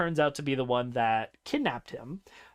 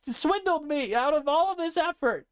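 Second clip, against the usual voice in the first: ranting insult; 14 semitones higher than usual. Acted. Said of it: "he just goes on a tangent".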